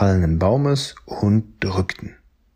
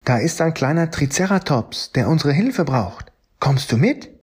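higher, eager storybook voice; Fred the dinosaur; 6 semitones higher than usual. Acted. Fred asks Lily to help the Triceratops, higher and eager. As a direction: ask for help in a lifted friendly voice.

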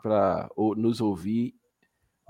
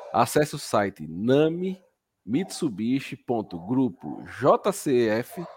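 over enunciating; slower than usual. Shown as a demonstration. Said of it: "Vou falar devagar".

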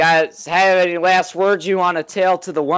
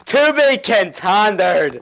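Family Guy quote; 3 semitones higher, much slower than usual. Retold or imitated. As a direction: stretch every word out slowly. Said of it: "and he says".

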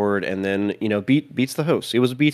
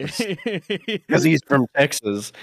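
laughing; 5 semitones higher, much slower than usual. Real, not acted. Laughing at the irony.

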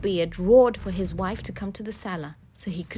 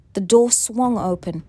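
usual speaking voice

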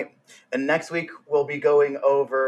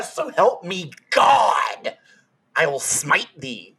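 slow booming prophet voice, spite threat; hammed-up biblical smiting proclamation; mock biblical prophet; much slower than usual. Mock threat in archaic speech.

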